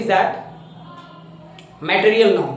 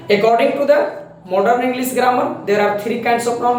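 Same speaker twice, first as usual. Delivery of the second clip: slow dictation; 3 semitones higher, much slower than usual. Shown as a demonstration. Teacher dictating slowly.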